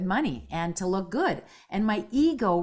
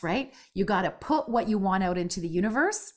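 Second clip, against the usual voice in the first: normal talking voice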